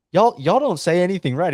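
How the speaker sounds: high, loud Alabama complaint; imitated Alabama accent. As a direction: scold outsiders as an Alabama boy. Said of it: "some boys from Alabama".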